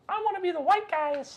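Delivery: put-on very high, excited little-kid voice; childhood self. Kid choosing characters in Battlefront, high-pitched eagerness. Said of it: "I was like".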